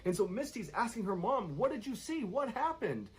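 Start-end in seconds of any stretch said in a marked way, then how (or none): 1.6-3.1 much higher, frantic retold panic; retelling the daughter's panic at her mother, frantic and high; panicked questions. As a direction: fire the questions off in a terrified rush. Misty begging mom to explain.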